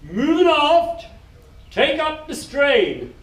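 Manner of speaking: loud, high and slow, each phrase spaced; slow shouted command. Retold or imitated. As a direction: drill order called down the deck. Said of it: "And, and then he says".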